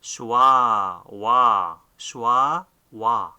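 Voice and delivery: shwa and wa called out loud and clear; teacher sounding out shwa, wa; sounding out the letter. Shown as a demonstration. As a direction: say "shwa" then "wa" slowly and loudly. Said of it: "the sounds of these two beth are".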